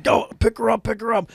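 high-pitched rescue command; retold urgent order. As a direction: call it out urgently to fellow rescuers.

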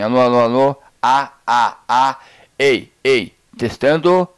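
full-volume sound check; loud mic test calls. Shown as a demonstration. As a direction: belt each test word at the mic. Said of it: "Vou aumentar o volume".

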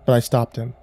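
ordinary delivery

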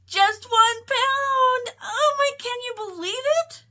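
very high, loud, drawn-out delight; over-the-top gleeful shriek; excited squeal. Real, not acted. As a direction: squeal it out in thrilled disbelief. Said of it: "I'm excited. Oh my goodness".

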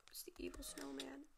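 breathy warning whisper. Real, not acted. Gamer whispering to viewers about the snowman. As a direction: hush it like a secret warning.

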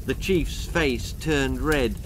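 plain reading voice

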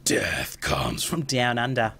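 slow, drawn-out ominous announcement; mock ominous voice. Capping the dance of death joke, mock ominous. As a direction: stretch it out like a grim omen.

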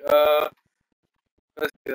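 ordinary delivery

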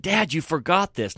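a hint of an innocent kid; little boy. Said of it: "this is just a little boy".

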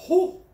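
very high, loud whooping whew; high whistling whew. Real, not acted. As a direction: let out a shrill astonished whew. Reacting to headline.

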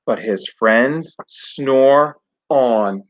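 put-on lively storybook read-aloud for kids; reading to children. A narrator's reading. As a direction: tell it like bedtime story to a class. Teacher reads picture book aloud.

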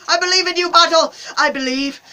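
loud, high shout of faith; fervent cheering. Real, not acted. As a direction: cry out your belief in your champion. Cheers the bottle toward a hundred.

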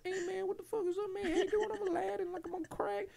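very high, fast, cartoonish stoner voice; crackhead Aladdin voice. Dubbed YouTube Aladdin, a squeaky crackhead cartoon voice. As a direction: squeak it out like a wired cartoon junkie. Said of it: "the guy voice, and he's like".

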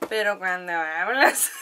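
slow teasing words that break into laughter. Retold or imitated. Friend teasing her about her voice, laughing. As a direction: laugh through it like a friend poking fun.

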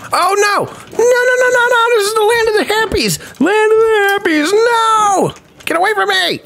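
squealed high, hammed-up panic; playful high-pitched screaming fright; mock panic. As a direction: shriek like you're being chased, half joking. Panicking at attackers in game.

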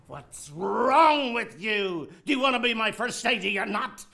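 loud, high-pitched scolding; exasperated director raising the voice; angry berating. Acted. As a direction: tear into the assistant. Director berates timid assistant.

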